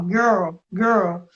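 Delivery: put-on girl said with clenched teeth, tongue cupped; girl with teeth shut. Shown as a demonstration. Teacher showing the trick for girl, teeth closed. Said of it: "tenta falar assim".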